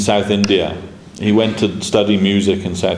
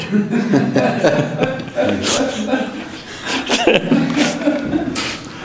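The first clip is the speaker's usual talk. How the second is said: long airy high-pitched laugh; giggling fit, mostly breath; high breathless laughter; 13 semitones higher, 3 dB quieter than usual. Real, not acted. Laughing at own dream story.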